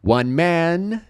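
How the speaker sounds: man with the mouth wide open. Shown as a demonstration. Teacher showing an open vowel, lifted. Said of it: "Você abre bem a boca".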